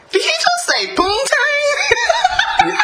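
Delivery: high, slow, laughing disbelief; incredulous question. Retold or imitated. Hearing Sabretooth's voice line, high slow laughing disbelief. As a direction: stretch out the shock, half laughing. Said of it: "'cause I was like".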